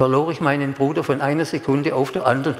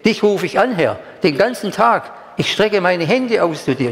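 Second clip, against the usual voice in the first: raised, earnest prayer reading; Gebet vorgelesen; 5 semitones higher than usual. Acted. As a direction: read the psalm as a heartfelt plea. Reads psalm prayer aloud. (feierlich vorgetragenes Gebet)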